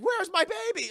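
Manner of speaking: high, loud searching call; frantic demand. Retold or imitated. Hungry monster mother frantically calling for her baby. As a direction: call out desperately. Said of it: "the female Mewtwo's like".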